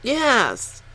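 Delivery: robotic phone menu voice; automated voice. Acted. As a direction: answer like a phone system. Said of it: "that sounded like an automated voice".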